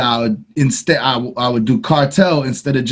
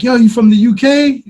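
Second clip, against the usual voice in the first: high, louder imitation of curious fans; retold surprised question; 9 semitones higher, 5 dB louder than usual. Host mimicking people who believed his English accent, high and surprised. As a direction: pitch up as an amazed fan asking.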